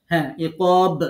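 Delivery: ইকব with the final ব bounced out loud; qalqala demo. Shown as a demonstration. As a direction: push the ব of ইকব with an echo. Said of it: "এরকম কলকলা দিন".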